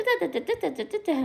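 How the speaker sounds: high rapid da-da-da; mock angry mom chatter. Imitating mom's angry scolding, high da's. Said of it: "when my mom is angry".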